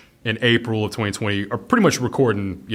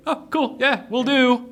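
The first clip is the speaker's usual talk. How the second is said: mock breezy owner; slower than usual. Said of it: "they're like".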